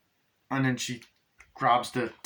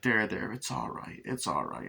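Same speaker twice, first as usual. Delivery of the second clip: soothing mother; 5 dB quieter than usual. Retold or imitated. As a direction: hush a crying child. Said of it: "is like".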